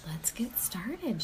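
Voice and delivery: a breathy whispered aside; hushed invitation to start. Real, not acted. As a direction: lean in and whisper it. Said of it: "whispered aside".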